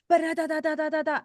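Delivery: high, loud rattling babble; rapid-fire chatter. Retold or imitated. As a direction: fire off syllables like a firecracker. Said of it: "fire and firecracker".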